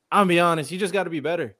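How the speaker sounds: usual speaking voice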